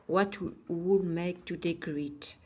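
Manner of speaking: slow English read with a French accent; unhurried French-accented English. Real, not acted. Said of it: "excusez mon accent".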